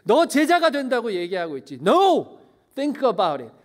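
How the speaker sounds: loud, high, demanding challenge; preacher booming Jesus' words; Jesus confronting the crowd. Acted. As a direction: call out the crowd's easy promise. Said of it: "오늘 예수님이 우리에게 말씀하세요".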